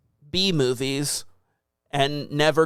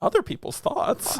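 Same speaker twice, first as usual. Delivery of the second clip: higher, breathy disbelief; incredulous echo; 9 semitones higher, 3 dB quieter than usual. Real, not acted. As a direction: repeat it back in disbelief.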